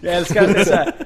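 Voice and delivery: loud, high, delighted laughing talk; cracking up while speaking; laughing hard. Real, not acted. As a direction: talk loudly through real laughter. Cracking up at co-host's mean joke.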